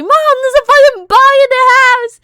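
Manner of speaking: high, loud panicked shriek; screaming for mom. Retold or imitated. Calling mom about a bug in the house, full high scream. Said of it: "I screamed for my mom".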